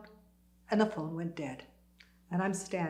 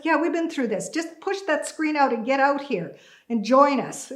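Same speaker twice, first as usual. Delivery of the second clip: loud, higher, insistent; impatient urging; 5 semitones higher, 7 dB louder than usual. Retold or imitated. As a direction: hurry a hesitant friend along. Urging friend out onto the ledge.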